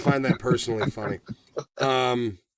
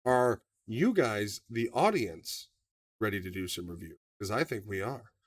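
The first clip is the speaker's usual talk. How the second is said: softer aside; 6 semitones lower, 7 dB quieter than usual. Real, not acted. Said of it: "quieter repeat of the question".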